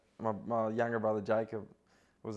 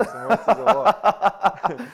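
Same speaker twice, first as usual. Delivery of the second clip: slow, high, loud laughing question; 11 semitones higher, 14 dB louder, much slower than usual. Real, not acted. Laughing speculation.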